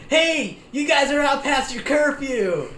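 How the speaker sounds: high, loud mock yell of an old lady. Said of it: "some old lady is yelling at us".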